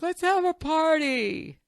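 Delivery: high, drawn-out mock-excited woman's voice; imitating partner. Partner eagerly proposing a house party, mock-excited. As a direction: squeal it long and high, mimicking her. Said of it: "Nia is a more open person. So she's like".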